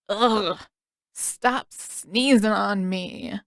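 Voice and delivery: slow, groggy delirious whine; sick boy grumbling at an imagined sneezer; feverish complaint. A narrator's reading. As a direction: mumble it half asleep and annoyed.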